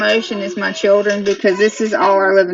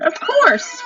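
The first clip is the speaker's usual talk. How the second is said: lightly voiced Farmer Ben. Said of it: "said Ben".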